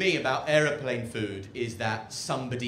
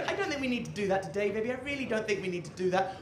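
lightly voiced partner; 7 semitones higher, faster than usual. Partner soothing the cook.

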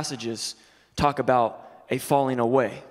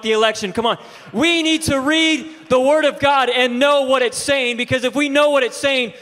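loud, higher preaching shout; rally the congregation; 8 semitones higher, 6 dB louder than usual. Acted. Pastor urges the church to read the Word, preaching loud.

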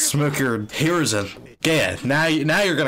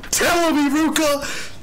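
high-pitched, drawn-out cheer; hyped cheering; 10 semitones higher, slower than usual. Real, not acted. A viewer urging Iruka on, hyped cheering. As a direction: egg the character on, pitched way up.

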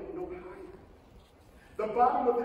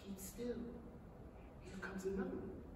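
much softer, near a whisper; hushed; 13 dB quieter than usual. Acted. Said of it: "whispered James".

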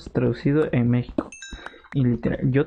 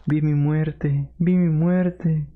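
dramatic slow; much slower than usual. Real, not acted. After near death in game.

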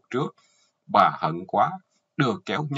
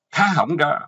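high, loud command; shouted order; 7 semitones higher, 7 dB louder than usual. Acted. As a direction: bellow it at the bound husband. Wife orders bound husband to open mouth. (quát ra lệnh, giọng cao và to)

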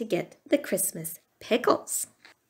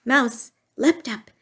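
plain reading voice

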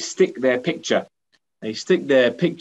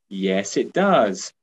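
ordinary delivery

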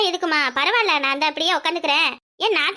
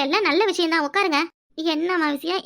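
ordinary talk

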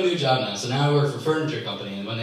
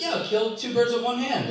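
lightly voiced confident lady customer, higher; higher, voicing the lady; 10 semitones higher than usual. Teller imitates customer mangling a saying, lightly voiced. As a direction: play the clueless customer, pitched up.

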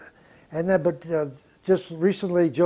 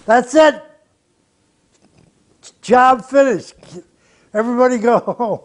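loud, higher, calling it done; triumphant announcement; 6 semitones higher, 8 dB louder than usual. Retold or imitated. Producer telling the musicians the session is over, loud. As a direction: call out to the whole room that the job is done.

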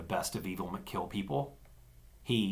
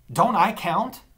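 bitter; 11 semitones higher, 8 dB louder, slower than usual. A narrator's reading. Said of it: "I asked bitterly".

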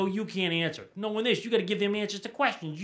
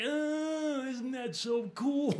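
sarcastic gush; 7 semitones higher, 5 dB quieter, much slower than usual. Acted. Sarcastic reaction to friend's boast.

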